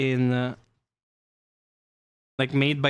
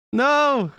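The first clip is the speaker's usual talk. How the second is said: high, loud cry of no; mock protest; 12 semitones higher, 5 dB louder than usual. Streamer recoiling from the accent joke, high loud protest. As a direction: cry out against your own joke.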